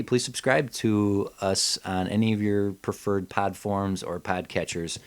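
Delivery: ordinary talk, nothing marked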